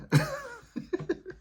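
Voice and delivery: squeaky high-pitched laugh. Real, not acted. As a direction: burst into a high giggle. Laughs at own story.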